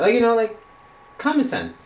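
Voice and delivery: exasperated outburst. Real, not acted. Ranting about rude customer.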